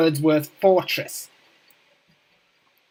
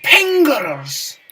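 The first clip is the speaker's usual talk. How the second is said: battle cry; 8 semitones higher, 8 dB louder than usual. Acted. Gamer playing a beaver monarch.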